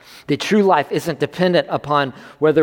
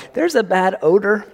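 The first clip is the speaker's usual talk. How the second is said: higher, wrinkled-nose whiny Martha voice; mock Martha complaining; 6 semitones higher, 3 dB louder than usual. Martha warns Jesus about the tomb, in a higher whiny voice. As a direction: play Martha fussing about the smell. Repeats Martha's line for laughs.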